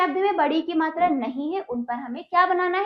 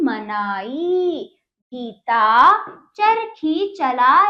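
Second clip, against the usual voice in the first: slow, louder classroom reading voice; story read deliberately for a class, louder than her explanations; slow read-aloud lesson; 4 dB louder, much slower than usual. Shown as a demonstration. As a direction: read the passage out slowly to young pupils.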